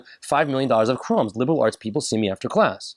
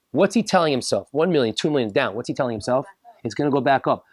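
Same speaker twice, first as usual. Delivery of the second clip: normal talking voice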